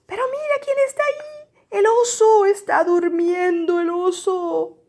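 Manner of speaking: high, wide-eyed storytime amazement; excited discovery. Acted. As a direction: point out a big surprise to a small child. Spots the sleeping bear in story.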